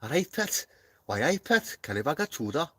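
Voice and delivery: high, exaggerated Geordie accent; a showy Geordie impression, pitched high. Shown as a demonstration. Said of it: "I can do a Geordie accent".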